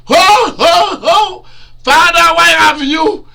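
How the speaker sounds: loud, high, anguished wailing cry; Jesus crying out. Acted. Jesus on the cross cries to the Father, loud anguished wail. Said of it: "they saw him shouting and crying".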